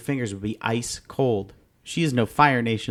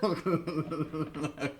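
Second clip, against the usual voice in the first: high squealing laugh; high burst of laughter; 5 semitones higher, 9 dB quieter than usual. Real, not acted. Laughing at the failed prank call, high squeal. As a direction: crack up at the recording. Laughs after recorded message.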